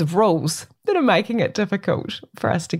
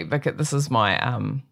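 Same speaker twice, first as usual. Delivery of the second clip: ordinary talk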